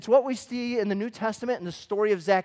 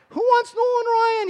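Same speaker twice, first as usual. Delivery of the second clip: mock scornful kid; 8 dB louder than usual. Mocking brother's card trade.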